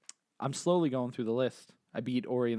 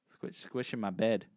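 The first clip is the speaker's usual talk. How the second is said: ordinary talk, nothing marked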